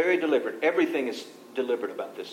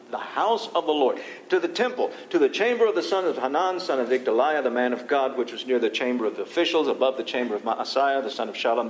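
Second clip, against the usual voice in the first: preaching at full force; 5 dB louder than usual. Acted. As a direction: proclaim each chamber to the hall.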